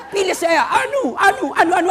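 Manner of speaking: high, loud candidate's plea; mock campaign shout. Nameless candidate begging votes from the crowd, loud and high. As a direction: call out for votes, hammed-up and high.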